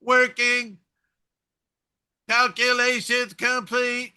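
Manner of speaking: flat robotic computer voice; monotone computer voice. Retold or imitated. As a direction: read it like a starship computer. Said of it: "this monotone female voice".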